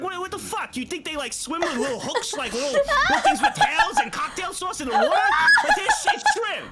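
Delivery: mock her with a rising laughing yell; laughing mock dad rant. Dad mocks mom, laughing.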